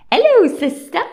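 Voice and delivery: "Hello, sister" very high and loud in exaggerated Cockney; hammed-up Cockney evil twin; Cockney impression. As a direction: ham up the evil twin's accent. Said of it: "That Cockney accent was not working".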